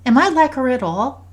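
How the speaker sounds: put-on high, hopeful child's voice; loud, high kid question; curious daughter. A narrator's reading. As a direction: ask it eagerly like a little girl. Voices daughter asking about her grandmother.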